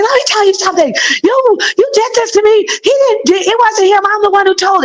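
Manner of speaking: high-pitched mock confrontation, pitched well up; retold confrontation. Retelling how she confronted her friend, high and heated. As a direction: jab the accusations out, pitched high.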